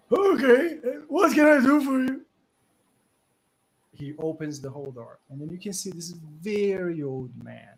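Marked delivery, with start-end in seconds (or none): 0.1-2.2 put-on high, loud old man suddenly polite; frail old man. Frail old man welcoming the party, suddenly eager. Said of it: "speaking with the last of his power".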